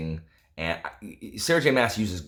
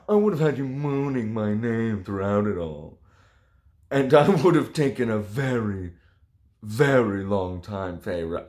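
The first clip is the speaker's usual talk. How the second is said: composed dramatic reading. Acted. Reviewer retaking the steamy line, mock sultry and composed. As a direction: get through it straight this time. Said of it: "Oh, sorry".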